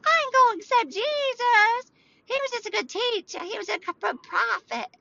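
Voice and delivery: very high, squeaky mocking voice; mock skeptic. Host mimicking a listener who won't accept Jesus, high mocking squeal. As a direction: squeal it like a caricatured scoffer. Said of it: "if you're like".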